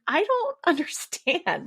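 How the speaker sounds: high, slow, laughing bewilderment; baffled laugh. Retold or imitated. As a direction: laugh out your helpless confusion. Babies cry on seeing her.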